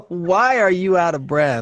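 laughing while asking; words through a laugh. Real, not acted. Laughing at co-host's state.

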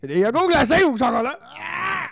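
excited. Real, not acted. Excited reveal of frozen yogurts.

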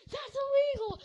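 high, loud indignant yelp; outraged shout. Retold or imitated. Acted outraged protest.

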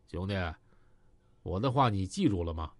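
low, cold and level, no warmth; a flat cold question from a man with a gun. Acted. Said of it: "海波冷冷地说".

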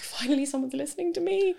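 high, wailed imitation of crying relief; mock-tearful, pitched right up; retold tearful relief. Patient finally believed by the consultant, high tearful relief. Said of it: "crying with relief".

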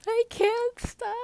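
high, loud, drawn-out bawling; hammed-up helpless crying voice; mock sobbing wail. Telling friend she can't stop, mock sobbing. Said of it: "could not stop sobbing".

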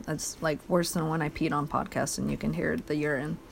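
ordinary talk

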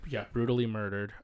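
ordinary talk, nothing marked

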